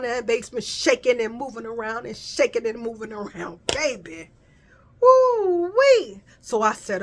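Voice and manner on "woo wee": high, loud holler; joyful whoop. Retold or imitated. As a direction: whoop with joy. Celebratory holler.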